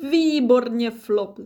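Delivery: loud, lifted cheer; proud, ringing congratulation; beaming praise. A narrator's reading. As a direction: praise your child with delight. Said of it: "zářil táta".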